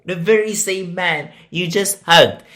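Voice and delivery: ordinary talk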